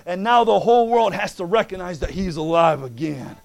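loud, rising preacher's cadence, punching key words; full-force preaching. Acted. Preacher exhorting the church about the bride, full force. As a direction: preach it to the congregation. Preaching over congregation amens.

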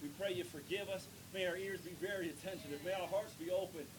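ordinary talk

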